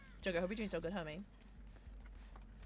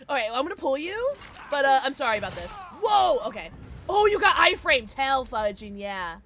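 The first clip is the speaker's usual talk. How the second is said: loud, high, hurried play reaction; raised, keyed-up gamer voice; excited gameplay reaction; 4 semitones higher, 10 dB louder than usual. Real, not acted. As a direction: blurt it out loudly mid-action. Excited reacting during live play.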